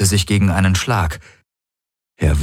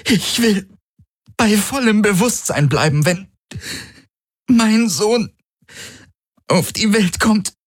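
strained woman in labour. A narrator's reading. Ylana refusing drugs mid-contraction. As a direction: insist through the pain.

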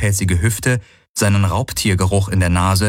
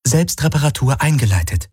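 ship computer voice; 6 semitones higher than usual. A narrator's reading. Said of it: "sagte das Schiff".

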